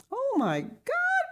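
very high, slow, drawn-out cry; startled cry. A narrator's reading. Blind grandmother hears someone come in, startled cry. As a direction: cry out in high-pitched shock. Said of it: "cried the voice from the corner".

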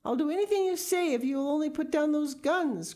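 high, loud begging; high-voiced bargaining for his life; desperate cry. A narrator's reading. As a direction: plead frantically with pitch way up. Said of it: "cried Mister Gregg".

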